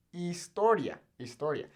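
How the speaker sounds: historia with no H, starting on the I; silent H. Shown as a demonstration. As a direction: drop the H in historia. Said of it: "the H isn't even there".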